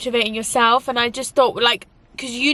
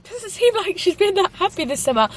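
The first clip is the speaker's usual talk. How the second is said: imagined viewer; 8 semitones higher than usual. Retold or imitated. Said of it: "I know this sounds a bit like".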